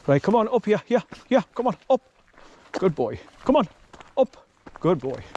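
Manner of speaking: high, bright call urging the dog up; coaxing the dog. Real, not acted. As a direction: cheer the dog up the slope.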